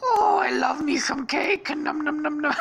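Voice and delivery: very high, loud cartoon voice; Stampy. Character delighting in cake, high gleeful cartoon voice. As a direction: squeal it out, then munch in a high voice.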